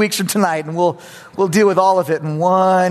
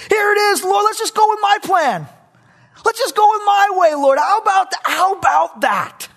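high, loud, insistent pleading; pushy pleading; 4 dB louder than usual. Acted. Abraham pressing God to take his plan, loud and high. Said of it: "what he's saying to God is".

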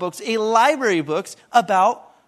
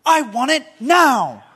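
slow, louder bratty demand; spoiled girl's demand; 3 semitones higher, 5 dB louder, slower than usual. Retold or imitated. Preacher acting Veruca Salt's last line, slow bratty demand. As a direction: stamp it out like a spoiled child. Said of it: "she says this".

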